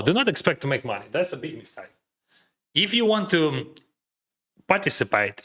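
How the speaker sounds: ordinary delivery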